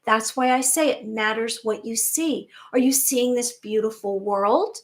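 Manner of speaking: ordinary talk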